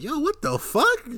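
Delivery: slow, very high outraged yelp; shocked outcry. Retold or imitated. Joey hearing about his sister, shrieking in shock. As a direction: stretch out a shocked squeak. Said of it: "and he's like".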